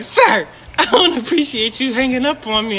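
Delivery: high-pitched mimicry of an offended customer; mock indignant caller. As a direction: imitate her scolding, amused. Repeats the indignant caller's complaint.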